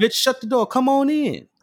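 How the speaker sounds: high, swaggering quoted line. Retold or imitated. Friend acting out the [name] line to tell [name] how to ask. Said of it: "Catch him with the [name] line".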